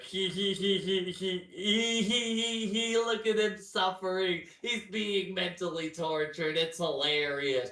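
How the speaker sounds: high, teasing he-he-he; mock gleeful laughter. Siblings in the hallway laughing at his suffering, mock gleeful cackle. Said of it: "Just laughing their fucking asses off".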